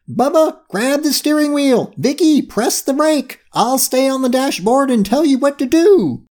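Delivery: high, urgent commands; taking charge. A narrator's reading. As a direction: squeak out orders like a tiny captain. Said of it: "Curie took charge and said".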